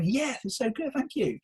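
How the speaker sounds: high-pitched happy thanks; smiling gratitude. Shown as a demonstration. Coach talking to a caller with a smile, delighted. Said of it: "you've got a smile".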